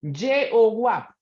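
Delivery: "Yehová" drawn out slowly as ye-o-wap; sounding it out. Shown as a demonstration. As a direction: demonstrate "Yehová" with a Y and a W glide. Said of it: "¿cómo sonaría?".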